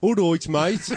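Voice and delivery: high, loud Wolverhampton greeting; Wolverhampton accent. Shown as a demonstration. Host showing how it differs from Birmingham, Wolverhampton accent. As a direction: put on a broad Wolverhampton accent. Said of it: "And this is a Wolverhampton accent".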